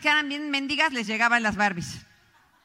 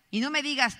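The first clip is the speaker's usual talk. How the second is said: ordinary talk, nothing marked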